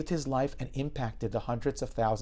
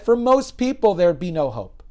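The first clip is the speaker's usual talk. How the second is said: louder and higher, pleading the patient's case; impassioned declaration; 7 semitones higher, 11 dB louder than usual. Retold or imitated. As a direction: push it out with conviction. Doctor passionately defends patient.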